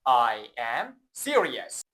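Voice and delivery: "I am serious" said very slowly, each word clear; slow model sentence. Shown as a demonstration. Teacher modelling "I am serious" slowly for the class to repeat. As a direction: spell it out for the learner to copy. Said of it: "با من تکرار کن".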